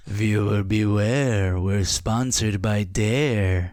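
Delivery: very slow, ominous mock announcer; spooky announcer parody. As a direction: drag out a spooky warning like a TV tagline. Parody of show's spooky sponsor tagline.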